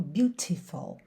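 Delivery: British "beautiful" with a crisp T. Shown as a demonstration. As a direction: keep the T clean in "beautiful". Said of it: "E no inglês britânico". ("beautiful" britânico, T limpo)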